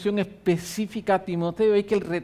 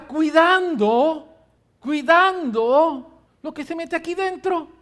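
high, loud, slow preaching build; fiery preaching; 7 semitones higher, 3 dB louder than usual. Acted. Pastor rallying his congregation, fiery preaching. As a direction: drive the point home from the pulpit.

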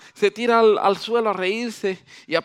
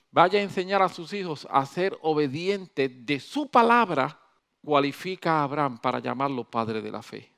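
ordinary delivery

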